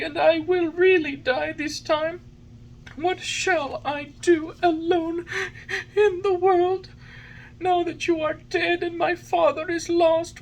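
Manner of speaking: high, wailing puppet sobs; sobbing. A narrator's reading. Pinocchio mourns the dead fairy and his lost father, sobbing. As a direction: wail it in a very high voice, drawing words out. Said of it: "As he sobbed".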